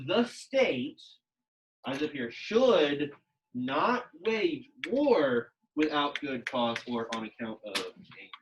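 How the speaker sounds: very slow, each word pointed out; slow pointing read. Shown as a demonstration. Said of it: "this is a very typical syntax".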